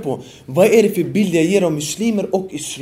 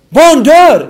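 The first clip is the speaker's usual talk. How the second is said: mock protest shout; 8 semitones higher, 11 dB louder than usual. Preacher mimicking protesters shouting at the mosque. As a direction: shout it out, much higher and louder. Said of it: "det ni skriker till oss".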